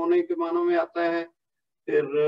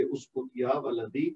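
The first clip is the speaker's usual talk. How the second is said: soft, tender اسکت یا ولدی, dropping softer and lower; teacher demos a tender اسکت یا ولدی; gentle, loving; 3 semitones lower, 7 dB quieter, slower than usual. Shown as a demonstration. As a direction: hush a child lovingly. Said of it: "پیار سے کہیں گے ... لہجہ آپ کا نرم ہوگا".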